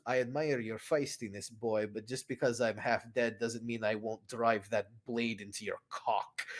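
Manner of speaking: low, quiet, half-dead menace; a threat from a half-dead man, low and soft; low menacing threat. Acted. Oberyn threatens the boy.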